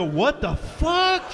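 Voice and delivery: high, slow, drawn-out disbelief; gawking drivers' astonished cry; stunned onlookers. Acted. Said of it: "people in their cars go".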